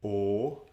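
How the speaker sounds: "O" as a pure Swedish rounded vowel; Swedish vowel demo. Shown as a demonstration. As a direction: say "O" with rounded lips, held clearly. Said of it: "try them with me".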